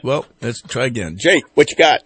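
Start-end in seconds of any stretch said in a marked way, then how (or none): 1.2-2.0 higher, louder call to the caller. Real, not acted. Host tries again to bring Jake on air, calling out. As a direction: call out louder to raise someone on the line.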